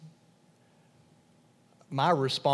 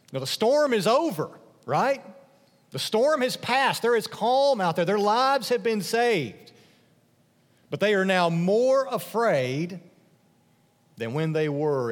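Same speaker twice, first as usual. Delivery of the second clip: higher and louder, building; rising fervor; 7 semitones higher, 5 dB louder than usual. Real, not acted. Preacher stressing the disciples' greater fear, higher and louder. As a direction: preach it with growing urgency.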